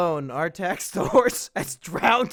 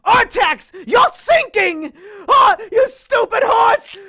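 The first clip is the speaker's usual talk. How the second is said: yelled high and loud, hammed-up; over-the-top mock yell at the horse; mock desperate shout; 13 semitones higher, 12 dB louder than usual. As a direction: play the boy screaming at his sinking horse. Retelling Atreyu shouting at horse.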